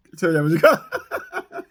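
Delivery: breaking into laughter mid line; real giggles; laughing through it. Real, not acted. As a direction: crack up while saying it.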